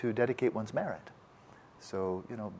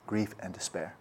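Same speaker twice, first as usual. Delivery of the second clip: soft echo; 2 semitones lower, 9 dB quieter than usual. Shown as a demonstration. Teacher echoing the phrase to students, much softer.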